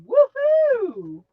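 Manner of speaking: slow, drawn-out woohoo, lightly imitating a friend; Blossom's woohoo. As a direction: stretch it out like Blossom does. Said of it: "as Blossom says".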